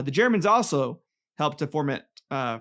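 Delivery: ordinary talk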